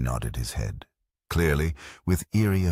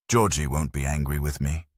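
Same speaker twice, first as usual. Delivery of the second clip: ordinary delivery, nothing marked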